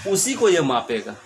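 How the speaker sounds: ordinary talk, nothing marked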